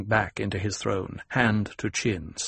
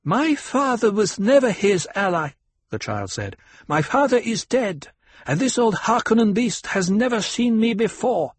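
put-on high, gleeful child voice; a little girl relishing his fear; young girl voice; 12 semitones higher, 4 dB louder than usual. A narrator's reading. Alia voiced by narrator.

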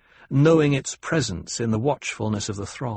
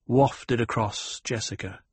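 usual speaking voice

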